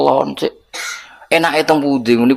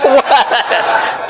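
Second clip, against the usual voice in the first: laugh; 12 semitones higher than usual. Real, not acted. Joke about heaven.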